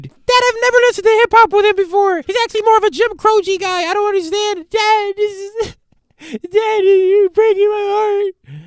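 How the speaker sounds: very high, slowed mock pleading as the daughter; hammed-up girl whining that her heart is breaking; heartbroken daughter.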